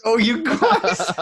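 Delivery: put-on high, slow laughing Long Island lady accent; drawn-out mock Long Island lady. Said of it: "Some Long Island lady".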